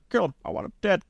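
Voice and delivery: high, mock sinister order; mock villain George. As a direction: play George as a hammed-up villain. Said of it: "in the back room".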